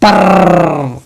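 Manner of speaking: low drawn-out "पर" on a rolling r; second syllable. Shown as a demonstration. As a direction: trill out the end of "पर". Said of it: "दो syllable में टूटा".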